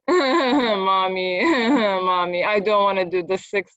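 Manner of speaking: imitated tearful whine to mommy; whining child. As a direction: mimic a child whining in protest. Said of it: "this constant whining".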